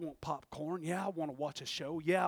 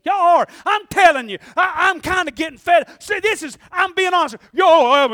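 much higher and louder, a frantic yell; screaming at top of lungs; 9 semitones higher, 18 dB louder than usual. Retold or imitated. Preacher acting out screaming from the oven, frantic yell. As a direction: yell for all you're worth. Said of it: "I'm screaming at the top of my lungs".

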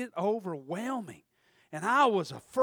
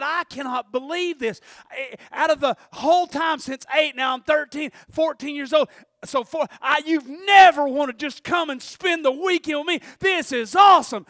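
loud, higher, thrilled father voice; overjoyed dad; 4 semitones higher, 8 dB louder than usual. Retold or imitated. Father shouting with joy that his son wants to visit. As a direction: boom it out like a delighted dad.

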